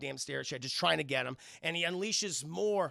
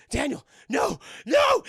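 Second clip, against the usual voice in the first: high shouts building louder; panicked pleading; 10 semitones higher, 11 dB louder, slower than usual. Retold or imitated. Burglar under his partner's raised crowbar, panicked shouting. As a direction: beg in hammed-up terror.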